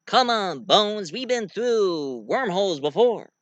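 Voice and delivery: high, lilting captain impression; hammed-up heroic captain; starship captain. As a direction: coax the crew with swooping emphasis. Captain urges his doctor and officer.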